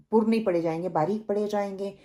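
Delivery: normal talking voice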